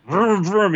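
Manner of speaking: high mock engine noise; chainsaw vroom. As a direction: make the chainsaw rev with your mouth.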